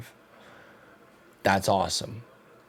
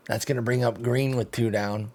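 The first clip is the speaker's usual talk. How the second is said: usual speaking voice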